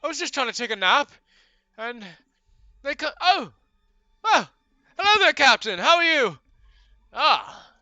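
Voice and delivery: high-pitched owlfolk stranger. Acted. Bird-man begging the party for help, high and fussy. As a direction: chirp it out, flustered but courteous. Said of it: "he turns and looks and goes".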